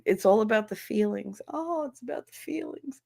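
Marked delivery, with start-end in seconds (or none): 1.5-3.0 breathy whispered mockery; mock simpering whisper. Mocking administrators' feelings talk, in a whisper. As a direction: sneer it softly like a precious cliche.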